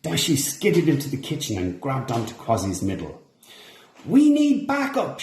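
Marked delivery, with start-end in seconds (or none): 4.1-5.2 high, loud, slow shout. A narrator's reading. Dashi holding onto Quasi, high loud shout. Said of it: "she shouted".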